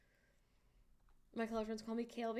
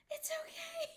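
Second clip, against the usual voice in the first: whisper; 4 dB quieter than usual. Real, not acted.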